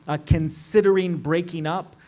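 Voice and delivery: usual speaking voice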